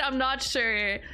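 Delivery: ordinary talk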